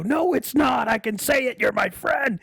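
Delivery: high yelled defiance; shout. Retold or imitated. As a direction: yell it back at him. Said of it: "And I go".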